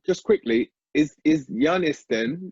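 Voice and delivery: normal talking voice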